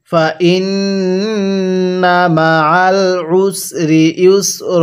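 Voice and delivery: stretched syllables, measured Arabic reading; slow recitation. Shown as a demonstration. Teacher models the word slowly for learners to copy. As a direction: draw out ওয়া র ফা না evenly. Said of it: "আমার সাথে মিলিয়ে পড়বেন।".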